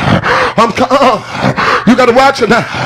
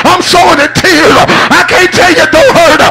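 high, loud, half-shouted preaching with a cry in it; sermon climax, chanted and shouted over the organ; preaching at full force; 7 semitones higher, 3 dB louder than usual. Acted. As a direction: whoop it up to the congregation, rising and pleading. Preacher builds to climax over music.

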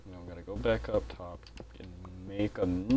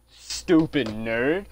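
slow angry insult; 4 semitones higher, 6 dB louder, much slower than usual. Real, not acted. Insulting villager in his bed.

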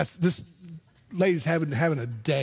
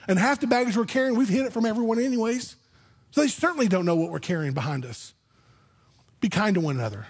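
ordinary delivery

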